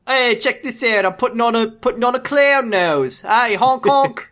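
clown it up for Ricky; silly clown. Acted. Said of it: "Now that [name] was voicing him".

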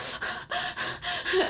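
high breathy panicked gasping; hyperventilating gasps. Acted. Trinity panics in the corner, gasping. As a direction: gasp for air in a panic. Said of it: "hyperventilate in the corner".

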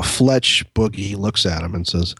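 normal talking voice

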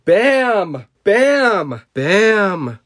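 loud, high drawn-out bams; high cartoon explosion shouts; shouted sound effects. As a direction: belt out each bam like an explosion. Acting out story's sound effects.

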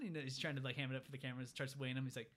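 normal talking voice

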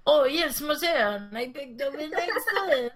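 a high, hammed-up French accent; mock French accent. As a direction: play a smug French impostor charming the mother. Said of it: "French listeners".

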